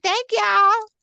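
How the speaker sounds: high, gushing thank-you. Retold or imitated. Thanking followers in a gushing high voice. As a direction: overflow with grateful thanks.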